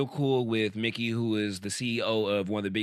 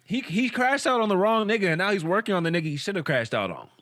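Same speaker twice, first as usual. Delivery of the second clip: high, louder, emphatic rebuttal; heated arguing; 8 semitones higher, 4 dB louder than usual. Real, not acted. Debating a friend over Eso's crash out, heated. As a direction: argue it hard, pitch riding up.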